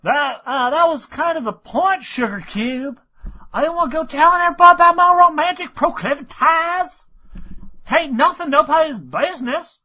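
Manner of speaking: flustered farm mare snapping at her gossipy friend; Applejack voice. Acted.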